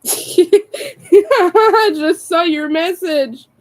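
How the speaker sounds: high, loud, drawn out through laughter; laughing. Real, not acted. Streamer reacting to a friend's message, laughing. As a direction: burst out laughing while speaking.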